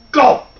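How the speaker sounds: sudden high, punched gulp; loud gulp. A narrator's reading. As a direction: pop out a big storybook gulp. Swallowing sound effect in story.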